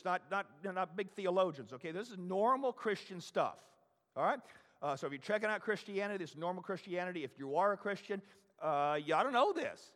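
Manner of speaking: ordinary talk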